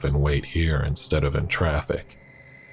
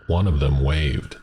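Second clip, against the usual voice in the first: ordinary delivery, nothing marked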